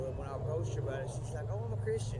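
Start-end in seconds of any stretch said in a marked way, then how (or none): 1.5-2.2 high, loud mock of her breezy excuse; mimicking the woman in the Ouija shirt, high and mocking; mocking quote. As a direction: toss it off high as a dismissive mock.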